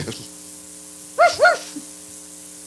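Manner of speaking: sudden very high yelp; dog bark. Acted. Preacher plays grumbling believer, a sharp high yelp. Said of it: "I'm gonna stop barking".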